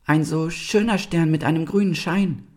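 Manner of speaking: very high, small fawn voice; braver little sister. A narrator's reading. Little sister speaks from behind her sister, timidly braver. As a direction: chime in high, newly emboldened. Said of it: "Jetzt wurde auch die kleine Rike mutiger".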